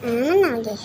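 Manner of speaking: ordinary talk, nothing marked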